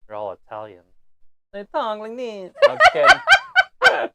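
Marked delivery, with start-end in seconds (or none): 1.5-2.5 higher-pitched mock Italian-American accent; mock Brooklyn Italian accent. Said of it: "How do Brooklyns talk?". 2.7-4.1 high, loud laughing disclaimer; laughing hard. Real, not acted. Laughs after own mock accent.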